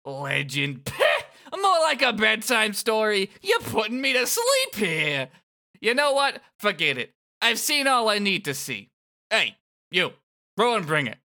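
high, loud taunting thug voice; mock the kid like a cocky bully; sneering villain. Voicing the sneering villain Kubo.

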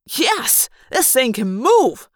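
high yes, then loud slowed exultation; exulting. Acted. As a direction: crow with delight at the speed. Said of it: "Marco exulted".

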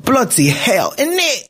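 put-on London roadman speak; roadman accent. Host playing a roadman to open his show, roadman accent. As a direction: play a London roadman, protesting. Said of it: "I gave you my best, like, roadman speak".

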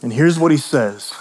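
normal talking voice

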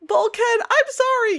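sing-song chiding of a character; playful scolding; mock apology. Real, not acted.

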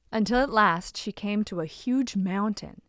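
ordinary delivery

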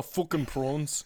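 put-on slow movie-line South African accent. Co-host quoting the one movie line, slow South African accent. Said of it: "only South African accent I can do".

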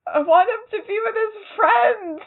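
high whiny mock sob; mock crying. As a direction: whine like a fretting parent. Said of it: "the other part of me was like".

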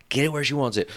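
usual speaking voice

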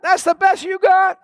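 high, shouted challenge to the devil; shouted taunt. Pretend. Pastor daring the devil, a high shouted taunt.

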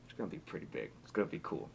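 whispered secret. Real, not acted. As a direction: hush it like a secret. Teasing a secret project.

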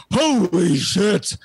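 slow, drawn-out awe; awed. Retold or imitated. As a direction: let the awe stretch out. Everyone reacts to stunning actor.

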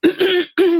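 clears her tired throat; sharp throat clear. Real, not acted. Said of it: "excuse me".

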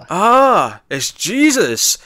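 high, loud "ah", then drawn out; hammed-up dawning wonder, stretched slow; mock realisation. Whole crew suddenly getting it, in mock awe.

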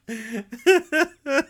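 high-pitched real giggle; laugh. Real, not acted. Laughs after the recap ends.

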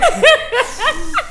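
giggling while quoting; words squeezed out laughing; laughing through words. Retold or imitated. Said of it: "He had that look like".

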